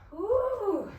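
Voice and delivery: high, loud whoop of encouragement; excited cheer. Real, not acted. Instructor whooping to pump up her class, high and loud.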